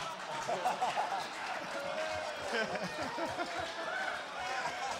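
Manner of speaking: long helpless high laugh; long high laughter. Real, not acted. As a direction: laugh it out freely. Laughing at own story.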